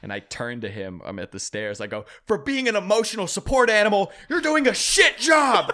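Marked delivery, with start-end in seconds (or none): 2.3-5.7 high, loud outburst at a dog; retold angry yelling. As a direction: shout an exasperated telling-off, building. Acted yelling at barking dog.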